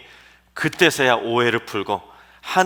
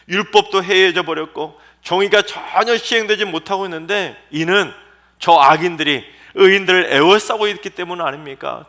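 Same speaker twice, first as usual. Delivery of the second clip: louder, higher acted plea; pleading to God; 4 semitones higher, 6 dB louder than usual. Preacher voicing Habakkuk begging God to judge, raised plea. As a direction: beg God to act, rising. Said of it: "심판을 호소한 것입니다".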